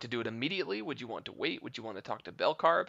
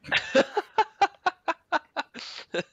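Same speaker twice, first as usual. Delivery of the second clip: big high laugh; 7 dB louder than usual. Real, not acted. Player joke.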